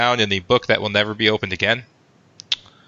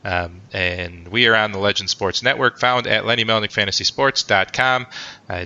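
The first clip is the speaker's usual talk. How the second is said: ordinary delivery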